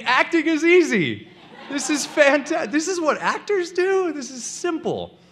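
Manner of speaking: thrilled to get paid for nothing; giddy delight. Retold or imitated. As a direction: gloat in giddy disbelief. Giddy inner thought.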